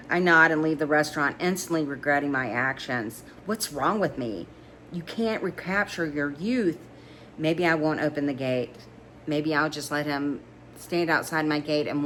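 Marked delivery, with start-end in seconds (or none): none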